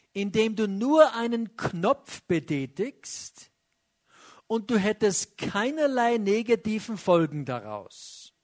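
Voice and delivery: ordinary delivery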